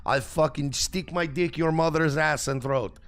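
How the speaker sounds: loud mock Turkish accent, broken English; mock Turkish troll. Reading angry Turkish messages aloud, mock Turkish accent. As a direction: read hate messages in a hammed-up Turkish accent. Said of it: "people calling me son of bitch".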